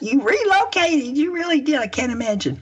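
high, amazed exclamation. Real, not acted. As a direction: marvel at it, pitch soaring. Amazed at guest moving abroad.

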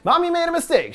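high-pitched, lightly slurred mother. A narrator's reading. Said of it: "she slurs".

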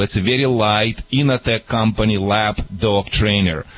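normal talking voice

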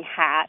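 "hot" imitated almost as "hat"; Chicago-style "hot", leaning toward "hat"; fronted vowel in hot. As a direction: say "hot" with the vowel pushed forward. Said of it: "We have two good tokens of".

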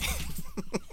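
breathy giggle breaking through; giggle burst. Real, not acted. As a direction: let a stifled giggle slip out. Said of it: "Don't laugh at me".

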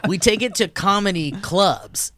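ordinary delivery

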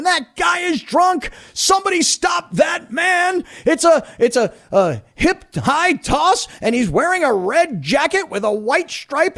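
high, drawn-out announcer's mock scream; imitating screamed play-by-play. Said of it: "Kevin Harlan screaming".

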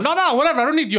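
high and loud, brushing him off; cartoonish yelling. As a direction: bark it down the phone like a cartoon. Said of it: "He sounds like a fucking cartoon".

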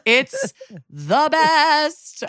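high, very drawn-out delight; real delight, stretching every word; delighted gushing. Real, not acted. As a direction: gush slowly and high, straight out of laughter. Gushing after laughing at impression.

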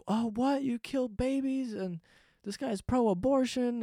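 high, drawn-out scandalized questioning; mock outrage. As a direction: imitate an appalled critic. Voices outraged critic of candidate.

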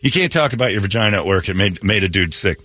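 ordinary delivery

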